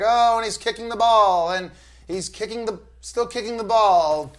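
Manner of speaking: high, loud, slow, bored play-by-play parody; mock soccer commentator. Mocking soccer to a friend, as a hammed-up commentator. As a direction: ham up a dull soccer call.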